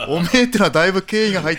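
explaining through laughter, fast; laughing. Real, not acted. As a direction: giggle the whole line out. (笑いながら早口で)

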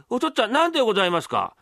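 high, polite son voice; imitating the young master. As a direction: answer dutifully in a mimicked voice. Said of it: "俺の声色がうまい".

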